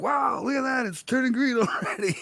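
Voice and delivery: high, bright amazed exclamation; amazed wow. Retold or imitated. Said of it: "I was like".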